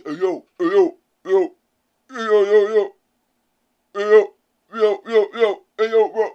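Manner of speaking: mock-macho calling, loud and forced; put-on manly voice. Female friend trying to imitate the guys, forced manly voice. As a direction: imitate someone faking a man's voice. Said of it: "she's using her manly voice".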